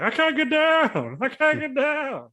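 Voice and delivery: very high, slow put-on voice; Cheeks voice. Stuck on the top rope, Cheeks calling out in a very high slow voice. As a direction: stretch it out way up high like a big helpless guy. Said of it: "is that what Cheeks sounds like".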